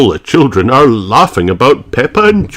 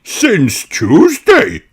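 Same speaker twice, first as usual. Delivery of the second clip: put-on slow, high old rabbit voice; earnest answer; Grampy Rabbit; 14 semitones higher, much slower than usual. A narrator's reading. Grampy Rabbit answering Peppa, slow old voice.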